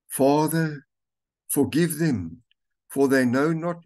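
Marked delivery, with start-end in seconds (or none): none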